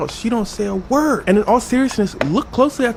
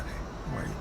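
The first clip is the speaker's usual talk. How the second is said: whispered mock-cool hello; hushed greeting; 7 semitones lower, 17 dB quieter than usual. Tom Cruise greets Oprah's crowd, hushed whisper. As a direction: whisper it, barely any voice.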